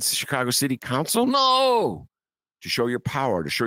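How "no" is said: much higher, louder flat refusal; a high, loud put-on "No."; mock imperious mayor. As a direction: slam it shut like a tyrant mayor. Playing the all-powerful mayor.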